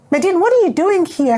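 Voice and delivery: high, quick retold astonishment; startled demand. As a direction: imitate a friend finding her where she shouldn't be. Angeline startled to find her there.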